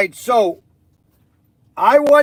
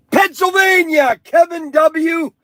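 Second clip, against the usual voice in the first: excited shout; 8 semitones higher, 5 dB louder than usual. Real, not acted. Announcing the contest winner.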